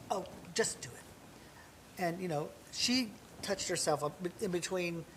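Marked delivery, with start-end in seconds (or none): 0.1-2.0 whispered urging. Retold or imitated. Mother urging him backstage.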